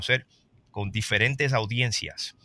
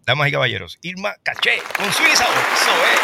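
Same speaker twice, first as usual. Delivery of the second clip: big showman's announcement, much higher and louder; announcer introduction; 8 semitones higher, 8 dB louder than usual. Acted. Host closing the show by presenting the singer, announcer style. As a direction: present her like a stage MC to a cheering crowd.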